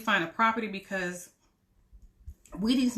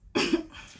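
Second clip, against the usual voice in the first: sharp high cough; a quick choked cough; 10 semitones higher, 3 dB louder than usual. Real, not acted. Live stream, choking on drink.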